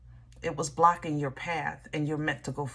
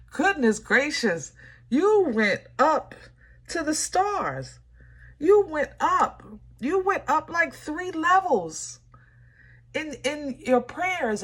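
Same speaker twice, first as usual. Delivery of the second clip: much higher, slow and amazed; emotional excitement; 9 semitones higher, 4 dB louder, slower than usual. Real, not acted. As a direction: marvel at how high they went, voice soaring. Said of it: "God, I am so emotional".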